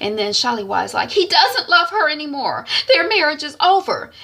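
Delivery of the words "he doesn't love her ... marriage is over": high, loud defence; insistent protest. Retold or imitated. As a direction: insist on it against her scolding. Sister protests in quarrel.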